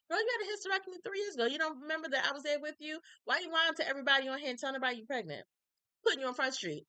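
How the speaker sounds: high, fast, sassy call-out; calling her out. Pretend. Mock friend calling out Taylor in comments, sassy and fast. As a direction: put her on blast, quick and high.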